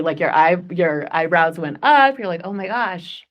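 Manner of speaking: ordinary talk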